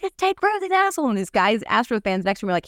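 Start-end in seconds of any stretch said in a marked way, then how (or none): 0.0-1.1 high-pitched mock outrage; indignant retort. Answering her son at the game, high indignant retort. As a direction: spit out the name with glee. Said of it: "I'm like".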